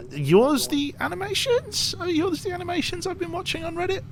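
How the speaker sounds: very high-pitched, amazed question; starstruck disbelief. Real, not acted. Streamer realising a chatter made the animations, starstruck and squeaky. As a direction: voice shoots way up in real amazement.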